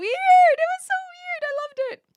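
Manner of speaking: very high, loud squeal; squealed delight. Real, not acted. Streamer squealing about the weird Pleco clip, high and loud. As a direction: shriek it up high with glee.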